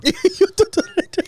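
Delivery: very high squeaky mock Irish "do"; mock Irish singsong. Laughing friend copies the squeaky high mock Irish. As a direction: echo the squeal in a cartoon Irish voice. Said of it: "this Irish is just".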